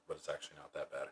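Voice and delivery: ordinary talk, nothing marked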